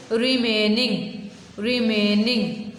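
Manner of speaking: Remaining drawn out very slowly; slow model word. Shown as a demonstration. Teacher slowly modelling remaining for pupils. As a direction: stretch remaining for the class.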